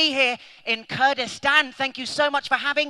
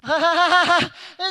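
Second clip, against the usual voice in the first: loud, high laugh; stage laugh; 4 semitones higher, 5 dB louder than usual. Acted. Comedian making an entrance, loud high laugh. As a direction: burst out in a big laugh.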